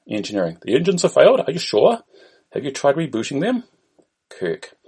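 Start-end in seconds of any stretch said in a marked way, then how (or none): 0.6-3.6 put-on high, questioning Indian accent; Indian-accented help desk query. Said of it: "An Indian voice is heard".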